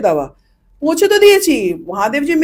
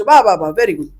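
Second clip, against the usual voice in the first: 1 sarcastic praise; 5 semitones lower than usual. Retold or imitated. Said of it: "রাগ উঠেছিল আমার".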